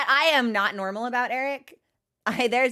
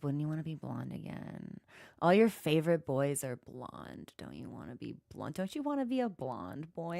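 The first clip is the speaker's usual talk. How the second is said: softer coaxing devil voice; tempting devil; 7 semitones lower, 9 dB quieter than usual. Acted. The devil coaxes her to dye her hair, softly. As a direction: coax her gently like a tempter. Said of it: "And the devil says".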